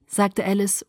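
usual speaking voice

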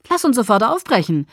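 loud, eager call to action; enthusiastic. A narrator's reading. Emmett pushing the family to set off at once, enthusiastic. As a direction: urge them on with lively force. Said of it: "sagte Emmett begeistert".

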